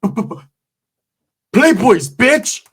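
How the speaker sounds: loud, high defiant shout; yelled gang call. Retold or imitated. Attacker yelling his gang name after the stabbing, loud defiant shout. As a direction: bark the gang name up the tier. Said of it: "He said".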